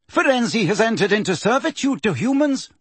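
high, hard accusing voice; grey centaur. Acted. Grey centaur accuses Firenze, high and hard. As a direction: condemn the traitor.

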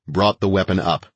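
ordinary delivery

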